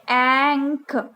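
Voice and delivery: एंक said slowly as one blended sound; glued sound ank. Shown as a demonstration. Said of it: "आप sound को फिर से सुनिए".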